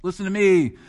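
a loud, high interrupting prophet; shouting prophet. Pretend. Prophet bursting into the service, shouting at the crowd. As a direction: burst in like a wild prophet crying out. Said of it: "imagine that".

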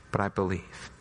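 low, hushed confession. Acted. The believer confesses faith, hushed and low. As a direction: let it drop to almost nothing.

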